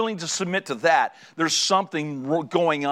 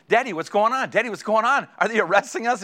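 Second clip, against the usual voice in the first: higher-pitched imitation of an anxious kid; worried child; 6 semitones higher than usual. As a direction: play a child pestering dad with worried questions. Voices his children's anxious questions.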